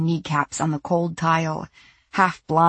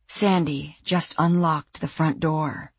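ordinary delivery

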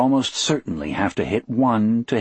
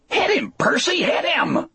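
high, urgent strained yell; screamed plea. A narrator's reading. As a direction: scream desperately for a colleague to act. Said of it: "Harry screamed".

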